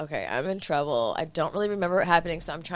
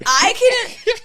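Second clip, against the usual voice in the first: high, loud protest; defensive squeal; 9 dB louder than usual. Real, not acted.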